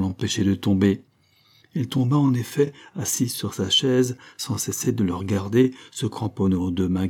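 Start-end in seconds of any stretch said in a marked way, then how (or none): none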